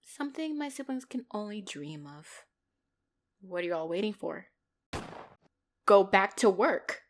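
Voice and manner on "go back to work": loud, slow, weighty order; commanding. Acted. Boss dismissing her gathered men, loud and commanding. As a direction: send them off with force, each word loud.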